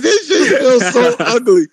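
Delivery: high, slow, laughing whine of complaint; laughing mock complaint. Retells own disgust, laughing.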